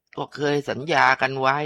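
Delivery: put-on cling to the old promise; stubborn female ghost. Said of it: "เป็นเสียงผู้หญิง".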